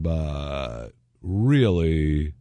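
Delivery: drawn-out, meaningful but really; slow knowing aside. Retold or imitated. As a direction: stretch it out slyly. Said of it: "we're saying".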